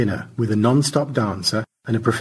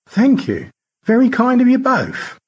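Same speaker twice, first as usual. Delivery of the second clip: much higher, lordly thanks; George, gracious; 6 dB louder than usual. Acted. Rescued astronaut thanking both rescuers, high and gracious.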